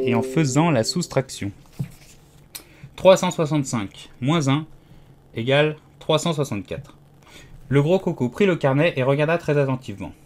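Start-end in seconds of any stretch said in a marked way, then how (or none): none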